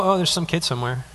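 soft, quick dismissive aside; mock indifference. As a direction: shrug it off under your breath, acting unbothered. Acts out indifferent parent, negated.

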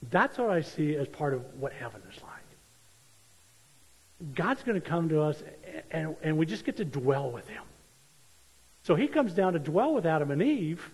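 normal talking voice

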